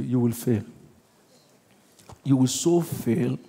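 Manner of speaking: low, slow taunting whisper of doubt; devil's taunt. Retold or imitated. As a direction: murmur it like a voice that undermines you.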